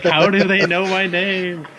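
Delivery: mock-bewildered whine; baffled victim. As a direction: play the spooked guest who can't understand. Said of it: "He's like".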